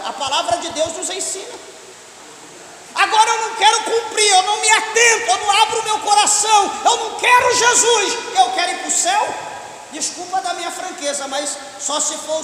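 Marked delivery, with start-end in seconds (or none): 2.9-9.3 high, loud defiant outburst; raised, punchy voice of someone refusing; defiant refusal. Acted. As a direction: act out a stubborn sinner shouting his refusal.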